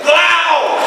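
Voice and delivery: barked German Blau, high and loud; mock threatening German shout. Shown as a demonstration. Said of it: "Really, like".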